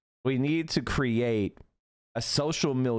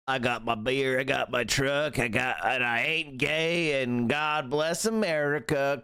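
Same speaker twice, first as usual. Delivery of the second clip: mock corporate country drawl. Streamer parodies corporate country singers in a slow Southern drawl. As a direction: parody a country-song good ol' boy. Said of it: "playing up their Southern drawl".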